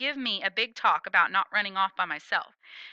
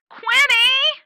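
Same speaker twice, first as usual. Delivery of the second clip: much higher and louder, a frantic call from far off; louder yell; 10 dB louder than usual. Acted. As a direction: yell it again with rising panic. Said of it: "says the yelling".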